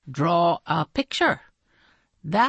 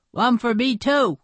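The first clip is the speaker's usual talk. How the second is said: put-on higher child voice; eager pupil; 7 semitones higher, 3 dB louder than usual. Calvin joining the requests, higher eager voice. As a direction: chime in, pitched up.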